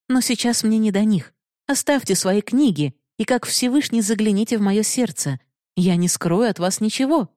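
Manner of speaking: Binodini's voice. Acted. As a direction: speak as the proud, challenging heroine. Binodini pleads with Bihari. (слегка повышенный голос героини)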